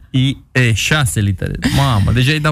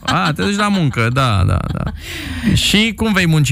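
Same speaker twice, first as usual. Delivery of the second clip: ordinary talk, nothing marked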